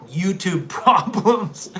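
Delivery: slow words broken by laughter; laughing. Real, not acted.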